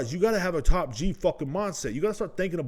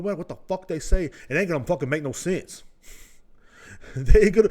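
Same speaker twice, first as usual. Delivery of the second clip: normal talking voice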